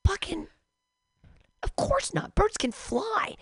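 high mocking exclamation, slow on the last words; mock outrage. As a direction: scoff at the idea like it's obvious. Mocking answer to the verse.

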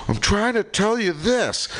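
high, slow exasperated protest; angry retelling. Retold or imitated. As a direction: imitate an angry man straining to be understood. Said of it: "he gets so angry".

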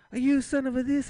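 high, loud, mock-screamed insult; furious mom yell. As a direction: chew the kid out in a rage. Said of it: "it's all in Spanish".

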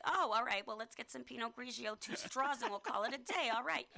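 lightly done Long Island voice; Long Island accent. Shown as a demonstration. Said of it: "your default voice is".

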